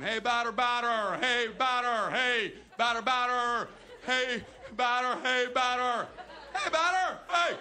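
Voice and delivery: loud, droning monotone heckle; infield chatter. Acted. Bored kid out in the field, mindless chatter. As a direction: chant it high, stretching the last syllables. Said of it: "Chattering is saying".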